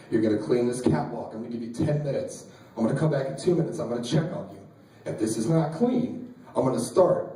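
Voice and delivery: low, level threat from a senior; menacing Marine senior. Acted. Senior Marine to new guys at four a.m., low and menacing. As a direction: lay down the threat low and controlled.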